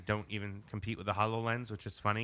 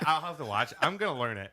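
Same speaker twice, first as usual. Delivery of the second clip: normal talking voice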